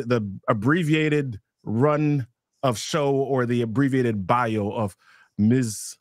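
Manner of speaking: normal talking voice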